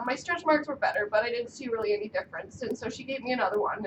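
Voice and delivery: usual speaking voice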